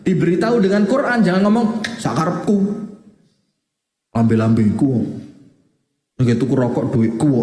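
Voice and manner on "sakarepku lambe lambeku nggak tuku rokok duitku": a hint of a careless Javanese loudmouth; mock the stubborn speaker; lightly voiced rude talker. Preacher mocking a rude man, lightly voiced.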